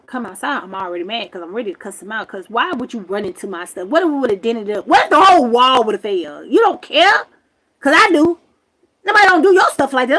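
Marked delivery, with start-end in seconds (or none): 4.9-10.2 loud, high, fast scolding; angry outburst. Real, not acted. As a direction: tell him off hard. Angry about damaged camper.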